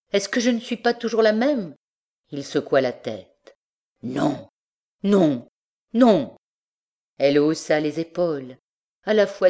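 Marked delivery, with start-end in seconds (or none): 0.1-1.8 voix de femme plus aiguë, un peu plus forte; rassure-le d'une voix de femme aiguë. Acted. Elle répond à son amant jaloux. 4.0-6.4 non de plus en plus fort et aigu; refus qui monte. Acted. Il refuse en secouant la tête.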